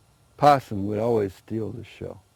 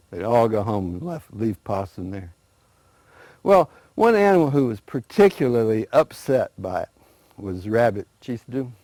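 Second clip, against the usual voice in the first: ordinary delivery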